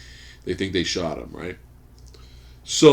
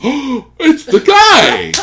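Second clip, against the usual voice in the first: much higher, louder, slow shocked cry; the thug spotting him, after a gasp; startled thug; 14 dB louder, much slower than usual. Retold or imitated. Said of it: "first criminal guy goes".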